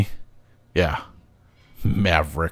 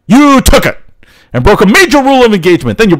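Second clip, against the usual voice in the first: angry rebuke; 9 semitones higher, 4 dB louder than usual. Acted. Commander scolds the pilot.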